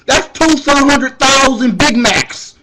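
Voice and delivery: loud, high, slow outraged count; comic outraged rant. Retold or imitated. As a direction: shout it out in mock outrage. Ranting about bail cost.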